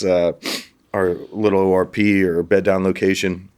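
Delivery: ordinary talk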